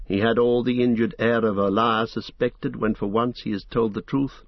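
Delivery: ordinary delivery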